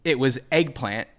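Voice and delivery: ordinary talk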